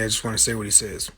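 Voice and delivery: ordinary talk, nothing marked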